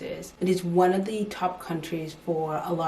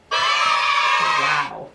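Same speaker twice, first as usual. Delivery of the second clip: amazed; 8 dB louder than usual. Real, not acted. Reacting to big news.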